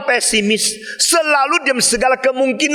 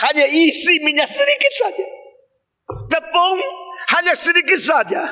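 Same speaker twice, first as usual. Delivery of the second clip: normal talking voice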